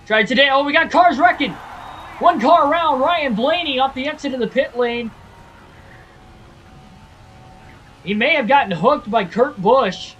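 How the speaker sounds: loud, high-pitched play-by-play on a wreck; excited race commentary. Acted. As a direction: call the crash like a hyped announcer. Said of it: "I sound like the announcer".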